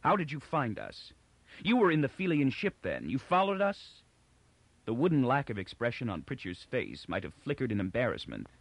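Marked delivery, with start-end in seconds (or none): 0.0-4.0 tense, higher accusing questions; mildly angry interrogation; suppressed fury. A narrator's reading. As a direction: demand it with fury held in. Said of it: "a twisted suppression of fury".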